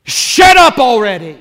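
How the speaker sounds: loud, high, harsh yell; angry crowd shout. Retold or imitated. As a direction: bark it at the beggar like a hostile crowd. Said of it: "They tell him".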